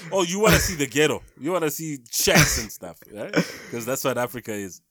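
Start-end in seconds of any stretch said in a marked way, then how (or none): 0.1-3.3 loud, lifted mocking offer; mock eagerness. Mocking films' view of Africa, sarcastically eager. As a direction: play up a sarcastic tour pitch.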